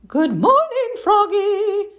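sunny mother frog greeting; cheery mom voice. Acted. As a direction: greet him like a bright cartoon mom.

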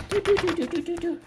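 high, rapid "do-do-do" knock effect; imitated door knocking. Storyteller fakes the knock on Donkey's door, high mouth sound.

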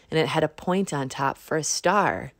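ordinary delivery

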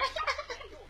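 light high-pitched laugh; high giggle. Real, not acted. Mother laughs with child.

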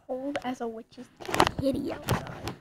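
ordinary talk, nothing marked

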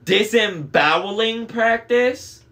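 high, loud, slow disbelief; alarmed question. A narrator's reading. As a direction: draw it out incredulously, rising high. Annabeth alarmed by announcement.

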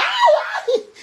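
very high, loud yelp; sudden piercing scream of "ay"; startled shriek. Real, not acted. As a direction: shriek out in alarm. Sudden yelp as light fails.

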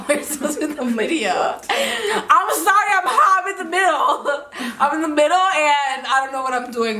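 flustered laughing apology. Real, not acted. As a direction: giggle through the words. Flustered about forgetting video.

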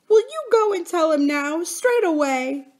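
tearful. A narrator's reading. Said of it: "asked Lucy Ann tearfully".

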